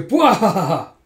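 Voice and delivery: loud, high performed guffaw; mock cackle. Drunks gloat over the dancer, cackling. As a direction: read out a villainous belly laugh. Imitated villain laugh.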